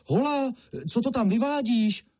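high-pitched hail to someone far up; calling out. A narrator's reading. Honza to the man twisting a fir tree, calling out. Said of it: "zavolal na něj Honza".

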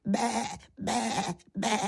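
high sheep bleats; ovčí bečení. A narrator's reading. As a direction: bleat like a little lamb. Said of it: "uměl jen ovčí řeč". (vysoké ovčí bečení)

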